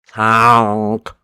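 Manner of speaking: loud mock horn honk. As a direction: blast it like a goose horn. Imitated horn sound.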